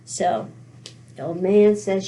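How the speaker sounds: usual speaking voice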